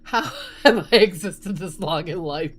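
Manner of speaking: ordinary talk, nothing marked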